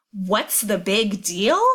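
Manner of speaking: slow, every word of "What's the big deal?" leaned on in disbelief; shocked stress on the whole line. Acted. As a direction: repeat "What's the big deal?" back to her slowly, stunned. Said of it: "So he's really stressing".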